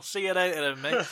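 high-pitched taunting goodbye; imitating a crowd's cheeky farewell; mocking send-off. Mimics crowd jeering departing fan.

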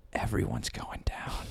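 whispered threat, voice almost gone; menacing whisper. Retold or imitated. Telling off the consultant, a whispered threat. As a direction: hush it like a mob warning.

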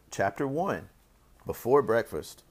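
ordinary delivery

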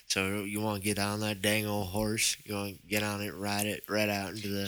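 low, soft drawled cowboy-talk impression; low-key, questionable movie-star impression; Patrick Swayze. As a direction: put on a quiet, dubious country accent. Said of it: "as Patrick Swayze would say".

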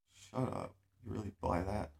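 normal talking voice

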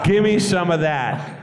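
re-savouring the line, mock smooth; slow cheesy come-on. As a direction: drawl it out like a lounge lizard. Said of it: "I got to say that to Bea Arthur".

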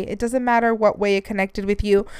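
ordinary talk, nothing marked

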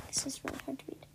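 whispered complaint. Real, not acted. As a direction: mutter it under your breath. Aside about typos.

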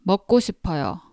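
quick, natural speed; fast. Shown as a demonstration. Teacher to student, showing the fast everyday version. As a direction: rattle it off at speed. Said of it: "if you make it faster".

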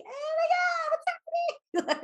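high panicked squeal. Retold or imitated. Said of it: "you're like".

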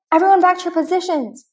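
high, loud urgent command; shouting orders. Acted. Ham ordering the toys back to their places, shouting. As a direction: bark the order to the whole room.

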